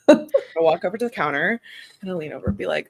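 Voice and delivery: ordinary delivery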